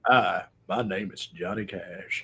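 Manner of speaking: low, slow country impression. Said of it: "sounded like [name]".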